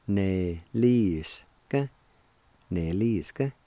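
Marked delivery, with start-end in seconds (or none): 0.1-1.9 neliska slowly drawn out; slow pronunciation. Shown as a demonstration. Teacher showing how neliska goes, slow and measured. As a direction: stretch each syllable of neliska. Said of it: "it's pronounced like this".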